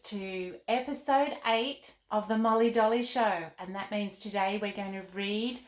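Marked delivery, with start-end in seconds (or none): 0.0-3.5 put-on welcoming the kids to the show; kids' show host. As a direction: greet the children like a TV host.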